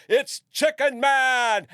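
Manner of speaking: high, loud, stretched-out holler; yell. Retold or imitated. Performer to his cult crowd, yelling out. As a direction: holler it like a superhero announcement. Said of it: "And I'd yell".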